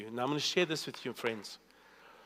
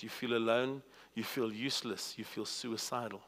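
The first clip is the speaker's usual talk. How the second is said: softer and lower, fading to almost nothing; fading despair; 4 semitones lower, 8 dB quieter than usual. Acted. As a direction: voice sinking to near nothing. Describing despair, voice sinking.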